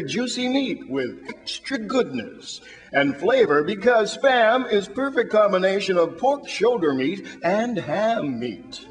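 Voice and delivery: ordinary talk, nothing marked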